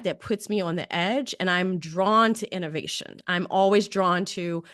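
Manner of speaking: usual speaking voice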